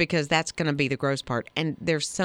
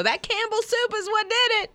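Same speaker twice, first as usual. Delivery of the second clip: high mock accusing voice, pitched well up; mock complainer; 14 semitones higher than usual. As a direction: whine it like a wronged customer, high. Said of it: "and you go".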